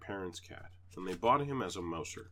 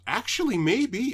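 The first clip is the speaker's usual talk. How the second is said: higher, louder lady's voice; neighbor's reply; 13 semitones higher, 10 dB louder, slower than usual. Retold or imitated. The neighbor mom considering the cat, higher and louder. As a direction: play the surprised neighbor lady. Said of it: "And she goes".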